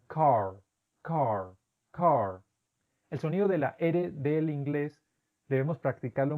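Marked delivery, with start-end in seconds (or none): 0.0-2.4 "car" with a full American R at the end; R pronounced. Shown as a demonstration. Teacher contrasting Boston with other American regions. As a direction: sound the R clearly in "car". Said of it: "otras personas en otras regiones".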